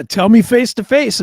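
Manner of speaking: ordinary delivery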